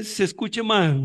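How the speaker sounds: usual speaking voice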